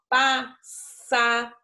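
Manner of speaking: "passaro" devagar, sílaba por sílaba, com um único som de S. Shown as a demonstration. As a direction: dizer "passaro" bem lento, separando as sílabas. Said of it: "a gente fala um S só". (som de um S só, bem devagar)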